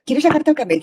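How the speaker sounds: sudden high, bright coaxing question; coaxing mother. Retold or imitated. Mother tempting her son with the driving licence: high coaxing. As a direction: dangle the offer like a treat.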